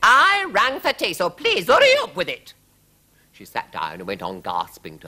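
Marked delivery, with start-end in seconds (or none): 0.0-2.5 high, loud royal demand; loud, high-pitched and peremptory; impatient queen. A narrator's reading. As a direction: order it shrilly as a fed-up queen.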